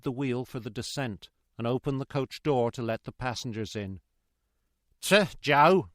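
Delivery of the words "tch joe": loud, high coachman's warning; warning cry. A narrator's reading. Coachman to guard from his box, loud warning cry. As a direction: call out a sharp warning. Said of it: "cried the coachman in a warning voice".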